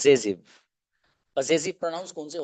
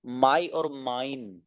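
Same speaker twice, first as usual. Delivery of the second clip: my and mine drawn out slowly; slow clear model; 3 dB quieter, much slower than usual. Shown as a demonstration. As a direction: present the two words slowly. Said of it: "teacher models words slowly". (my और mine धीरे-धीरे साफ़ बोलें)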